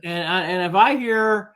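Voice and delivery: usual speaking voice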